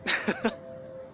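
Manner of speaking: soft, high, breath-only laugh; amused chuckle. Acted. Man teased by colleague, amused chuckle. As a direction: chuckle softly at the jab.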